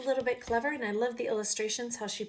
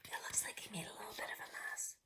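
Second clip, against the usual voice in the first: fast unvoiced whisper; quick whisper; 4 semitones lower, 14 dB quieter, faster than usual. Real, not acted. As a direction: hurry it out in a whisper. Whispered aside to viewers.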